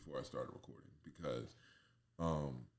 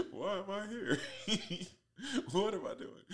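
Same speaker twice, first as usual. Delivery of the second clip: high-pitched, bewildered squeak; lost and baffled, way up high; confused high voice; 4 dB louder than usual. Retold or imitated. Confused self, acted high.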